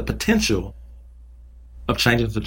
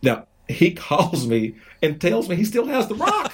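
chuckling through the words, pitched up; amused, laughing as the news comes out; 9 semitones higher than usual. Real, not acted. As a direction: laugh while you tell it. Laughing while telling the rock story.

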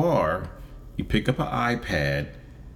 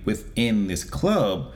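ordinary talk

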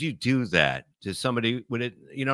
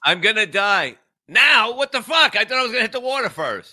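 mock panic; 9 semitones higher than usual. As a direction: play the doomed passenger shrieking in terror mid-fall. Voicing the falling Titanic guy.

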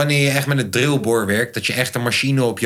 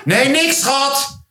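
high-pitched raised voice; calling back, oblivious; 11 semitones higher, much slower than usual. Acted. Playing hard-of-hearing boyfriend answering girlfriend, high calling. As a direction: call it out high to a partner. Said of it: "En jij zegt ineens".

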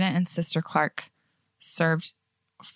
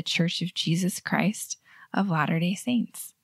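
name said unhurried, slowing at the end; proud, with meaning. Shown as a demonstration. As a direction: take time over the name, proudly. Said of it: "say it like you're proud of it".

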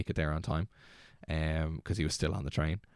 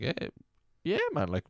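much higher, pumped-up answer; hyped reply; 11 semitones higher than usual. Retold or imitated. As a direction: sound totally up for it, high and bright with energy. Hyped answer to misheard question.

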